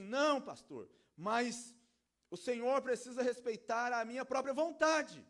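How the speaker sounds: loud, high protest from the pulpit; preacher acting out an indignant complaint; indignant objector.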